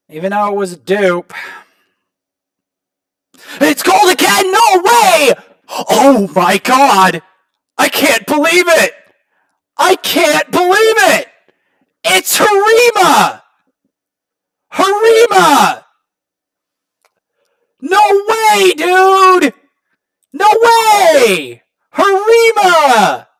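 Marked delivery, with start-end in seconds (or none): 3.6-8.9 much higher, louder, disbelieving yells; real overjoyed gamer screaming; ecstatic shout. Real, not acted. Second gold shard appears. 9.8-23.3 high, loud yells of disbelief; real joyful screaming, names stretched out; ecstatic screaming. Real, not acted. Pulls the champion he wanted.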